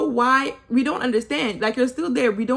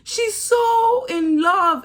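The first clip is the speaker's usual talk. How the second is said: high, slow, dreamy swoon; higher, slow, hammed-up swooning; mock lovestruck; 10 semitones higher, 3 dB louder, much slower than usual. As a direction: drag it out like a smitten girl. Mocking lovestruck Kira.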